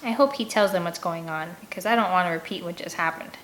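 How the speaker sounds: ordinary delivery, nothing marked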